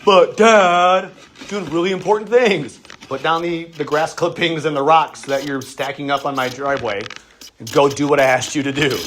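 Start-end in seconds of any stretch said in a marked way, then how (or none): 0.0-2.8 put-on high, louder kid's whine; child whining back at dad to stay at play; whiny protesting kid. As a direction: whine like a busy four-year-old.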